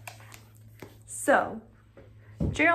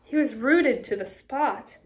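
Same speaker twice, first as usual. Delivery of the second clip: usual speaking voice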